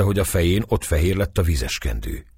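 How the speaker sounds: ordinary delivery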